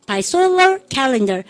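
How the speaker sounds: each word stretched very slow for the class to follow; drawn-out drill reading, very slow; slow choral model. Shown as a demonstration. Teacher leads the class in repeating, very slow. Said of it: "By solar 시작".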